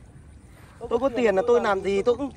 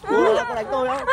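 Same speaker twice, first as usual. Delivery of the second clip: shocked disbelief; 12 semitones higher, 3 dB louder than usual. Acted. Man shocked she hit him.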